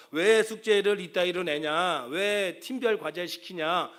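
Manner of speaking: loud, slow indignant scolding; angry complaint. Retold or imitated. Parent phoning a professor to complain, loud and slow. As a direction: demand answers like an outraged parent.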